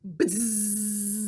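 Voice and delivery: bee buzz. Shown as a demonstration. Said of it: "Can you say that with me?".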